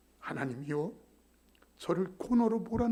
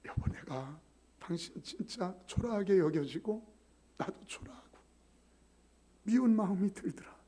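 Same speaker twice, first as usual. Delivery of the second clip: tender apology; 2 semitones higher, 3 dB quieter than usual. Retold or imitated. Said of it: "제가 가서 그랬어요".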